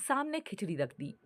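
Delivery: ordinary delivery, nothing marked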